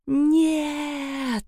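high desperate cry of no; shouting no. Acted. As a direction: cry it out in despair. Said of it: "закричала я". (отчаянный крик)

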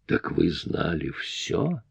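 шипение сквозь зубы, тихо и медленно; прошипеть угрожающе, медленно; hissing. A narrator's reading. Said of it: "прошипела она". (шипя)

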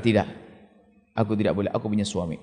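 a wife resisting temptation inwardly, quietly; soft self-denial. Retold or imitated. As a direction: hush it as a private vow. Wife fighting her feelings.

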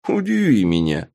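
lightly voiced smirk; a touch of a smirk. A narrator's reading. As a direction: toss out a mild, amused challenge. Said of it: "ухмыльнулся вампир".

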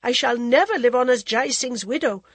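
high, loud proud refusal; defiant queen. Acted. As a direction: refuse with royal pride.

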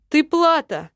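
loud, harsh demon bark; demon shout. A narrator's reading. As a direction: bark it at her like a verdict. Demon snaps at captive.

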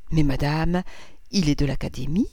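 understated, altered-voice irony; ironic retort. A narrator's reading. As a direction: object with light irony. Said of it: "répliqua le docteur d'un ton ironique". (réplique au ton légèrement ironique)

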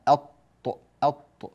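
الط cut off on a doubled ط; isolated الط with the ل swallowed into ط; assimilated lam. Shown as a demonstration. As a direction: go straight to the ط in الط, no ل. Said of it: "فننتقل إلى الطاء مباشرة ولا يوجد نطق للام".

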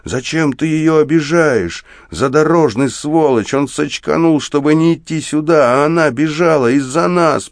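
sustained high-pitched indignant tirade; furious shouting. A narrator's reading. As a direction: berate him hotly, voice pitched far up. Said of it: "кричу я". (возмущённая гневная тирада на крике)

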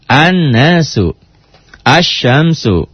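slow "An-nasu, asyamsu" with held n and sh; slow teaching demo of "An-nasu, asyamsu", l unheard; al left silent. Shown as a demonstration. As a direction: draw out the doubled consonants in "An-nasu, asyamsu", no l. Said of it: "al-alnya tuh tidak dibaca".

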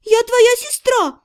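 high, slow outraged cry; protest, offended; indignant. A narrator's reading. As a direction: younger sister indignant at her sister. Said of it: "возмутилась младшая".

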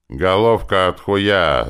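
slow, loud, venomous insult; spiteful. A narrator's reading. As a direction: spit it out with malice, drawing it out. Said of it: "злобно проговорил высокий колдун".

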